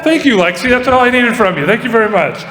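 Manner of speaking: high, pumped-up host voice; rally the crowd for applause; rousing applause call. Real, not acted. Host thanks Lexi and calls for applause, high and rousing.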